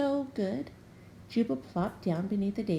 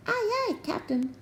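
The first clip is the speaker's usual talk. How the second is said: very high little crew voices; toadlets answering. A narrator's reading. The toadlets answering the captain, high and eager. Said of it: "the Toadlets agreed".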